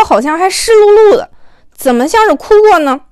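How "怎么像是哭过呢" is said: ordinary delivery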